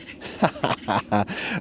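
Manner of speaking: soft laugh under breath; quiet amused laugh; breathy laugh. Real, not acted. Laughs after dog moment.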